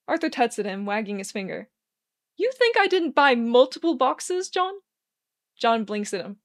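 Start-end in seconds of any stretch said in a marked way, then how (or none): 2.4-4.8 sing-song. A narrator's reading. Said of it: "Arthur says in a sing-song voice".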